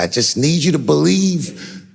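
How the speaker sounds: measured, insistent plea in her manner; imitated earnest plea. Daphne asking the comedian to believe her, imitated earnest plea. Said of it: "just like this".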